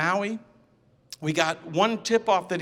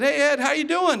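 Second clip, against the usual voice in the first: casual, higher and brighter greeting; playing it easy; 8 semitones higher, 5 dB louder than usual. Greeting his runaway friend, breezy and offhand. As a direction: act breezy like nothing's planned. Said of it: "I tried to play it easy".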